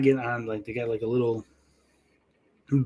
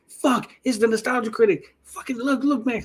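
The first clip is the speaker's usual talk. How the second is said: excited screaming; 10 semitones higher than usual. Retold or imitated. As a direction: squeal like a starstruck fan spotting a celebrity. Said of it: "And I start screaming".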